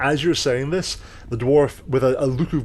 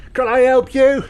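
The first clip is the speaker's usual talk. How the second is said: much higher, louder little gnome voice; 14 semitones higher, 9 dB louder than usual. Acted. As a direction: pipe up high and loud as a tiny gnome. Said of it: "Looks up and goes".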